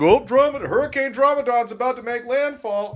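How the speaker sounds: high hammed-up forecaster voice; mock storm announcer. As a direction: tease like a news alert about a hurricane. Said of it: "it's like".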